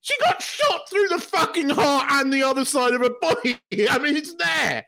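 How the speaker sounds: high-pitched, laughing disbelief; exasperated rant breaking into laughs; incredulous protest. Real, not acted. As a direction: argue the obvious, voice way up. Incredulous rebuttal of fans, laughing.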